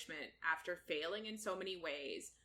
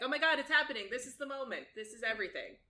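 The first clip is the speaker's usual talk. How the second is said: high, louder burst of excitement; excited reaction; 6 semitones higher, 6 dB louder, much faster than usual. Acted. Reader reacting to the long-awaited kiss, excited. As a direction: gush that it's finally happening.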